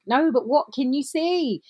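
high, slow, insistent "what can you see"; insistent prompting. Retold or imitated. Wife insists after wrong answer.

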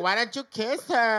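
high, slow, loud put-on voice of the pushy friend; mock best friend voice. Retelling the best friend's nagging, high mocking voice. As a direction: stretch it out in a mocking girly voice. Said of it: "she was like".

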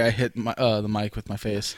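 ordinary talk, nothing marked

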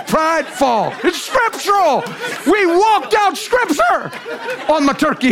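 triumphant laughing preaching; excited laughing. Real, not acted. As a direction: burst out with gleeful excitement.

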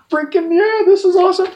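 high, loud thrilled shout of joy; thrilled exclaim. Retold or imitated. Walking on stage with Zombie, thrilled high cheer.

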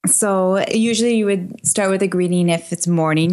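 normal talking voice

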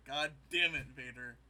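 loud mock exasperated curse; frustrated outburst. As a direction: blurt it out, fed up. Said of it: "He's like".

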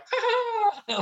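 high drawn-out wordless squeal; imitated high cry. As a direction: mimic her with a high-pitched cry. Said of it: "she's, like".

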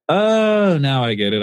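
a high "Oh" of dawning understanding; sudden realization. Retold or imitated. Realizing his friend listens to the podcast, surprised. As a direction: let it click all at once. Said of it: "I was like".

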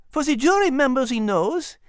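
high, small dog voice with a French touch; a tiny French dog explaining; high chatty dog voice; little French dog. A narrator's reading. Liz explains.

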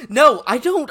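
normal talking voice

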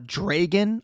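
"Dragon" said as DRAY-gun; long AY in the first syllable of "Dragon"; Midwest pronunciation. Retold or imitated. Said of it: "a Midwest thing".

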